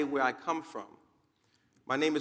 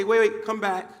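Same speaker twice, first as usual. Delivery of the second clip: loud, higher urgent call; urgent shout to come back; 5 semitones higher, 8 dB louder than usual. Acted. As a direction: call the runner back loudly. Flock calls runner back.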